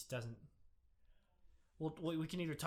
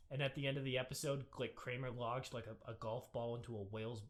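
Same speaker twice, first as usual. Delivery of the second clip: ordinary talk, nothing marked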